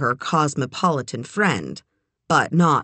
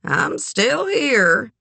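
slow, higher-pitched dry reply; 6 semitones higher, much slower than usual. A narrator's reading. Eleanor answers Sugar's greeting, dryly. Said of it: "said Eleanor dryly".

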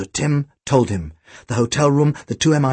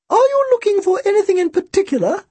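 put-on thin, very high old-woman voice; elderly shopkeeper, thin and high; Missus Bodega. A narrator's reading. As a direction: introduce yourself in a birdlike high pitch. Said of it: "Her voice was thin and high-pitched".

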